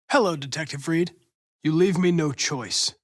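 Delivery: normal talking voice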